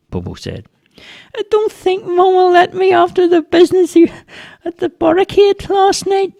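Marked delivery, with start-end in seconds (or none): 1.4-6.3 put-on very high squeaky child voice; doubtful little boy. A narrator's reading. Boy fearing mum won't let him out, doubtful. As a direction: pipe up doubtfully as a small boy.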